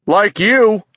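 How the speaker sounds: high, loud jeer; catcall. A narrator's reading. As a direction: heckle from the crowd. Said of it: "another giant cat called".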